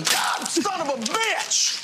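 furious outburst. Acted. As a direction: blow up at the student. Teacher explodes at student.

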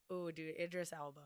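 ordinary talk, nothing marked

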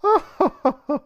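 high, loud put-on laugh; imitated laughter. Imitating mom laughing as she walks in, high mock laugh. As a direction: mimic a mom's burst of laughter. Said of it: "and she's laughing. She's like".

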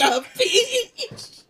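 very high, loud Italian-style squeal; laughing squeal. Pretend. Cracking up over the joke.

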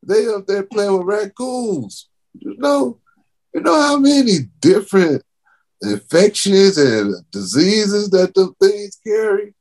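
high, rising incredulous rant; outraged rant. Real, not acted. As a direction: pitch up in disbelief at the parents. Outraged rant about kids.

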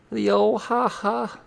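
high, slow put-on voice; jokey comedy voice. Host on Mark Russell doing comedy, jokey high voice. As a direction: draw out a high mock ha-ha.

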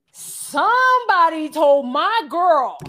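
loud, high, drawn-out bellow imitating an outraged man; mock outrage. Boyfriend confronting the men about his girl, loud mock outrage. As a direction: announce it slowly at full volume, hammed up. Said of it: "he's like".

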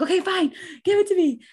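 high, loud fed-up surrender; exasperated giving in. Retold or imitated. Mother in labor giving in to the epidural, high exasperated yell. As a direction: throw up your hands and yell it.

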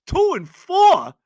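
you can't be serious; incredulous. Real, not acted. Host scoffing at fans who'd take two and four, incredulous.

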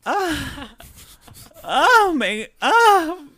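hammed-up horror, like an aunt seeing a near miss; mock pearl-clutching, high and loud, laughing through it; pearl-clutching shock. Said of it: "clutches his pearls and he's like".

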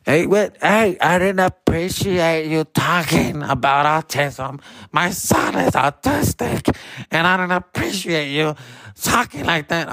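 high, slow mock drunk woman complaining; drunk angry lady. Drunk mom scolding the comedian after the show, high and slow. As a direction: play a tipsy offended mom, stretched out. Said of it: "she comes up to me, all drunk".

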